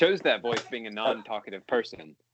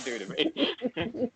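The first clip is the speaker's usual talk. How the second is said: laughing; 12 semitones higher, much slower than usual. Real, not acted. Laughing out of character.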